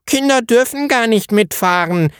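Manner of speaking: put-on high child voice, contradicting; small boy objecting. A narrator's reading. Leo contradicting Eddie, high child voice. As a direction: correct him like a know-it-all boy. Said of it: "widersprach der kleine Leo".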